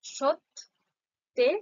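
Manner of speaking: সত্যেরে said very slowly as সৎ-তে-রে; slow syllable demo. Shown as a demonstration. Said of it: "যেমনটা উচ্চারণ করলাম".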